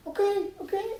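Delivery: very high, placating little voice; high-pitched mock compliance; compliant passenger. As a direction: give in meekly, way up high. Passenger gives in.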